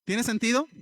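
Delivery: normal talking voice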